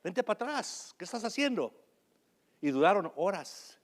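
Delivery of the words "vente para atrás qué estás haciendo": raised, high voice calling back the dog; retold shout at a misbehaving dog; shouted scolding. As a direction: scold the dog from across the yard. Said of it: "y les gritaba".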